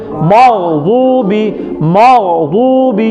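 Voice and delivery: مغضوب drawn out very slowly, heavy ض between dal and zal; drawn-out demo. Shown as a demonstration. Teacher drawing out مغضوب very slowly for the students. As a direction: stretch مغضوب slowly so the sound is clear. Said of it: "کیا نکلتی ہے؟".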